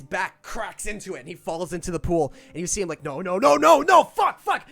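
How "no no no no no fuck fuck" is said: panicked cries building from loud to high shouting; rising panic. Acted. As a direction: climb into a desperate yell as you sink. Acting out man sinking in pool.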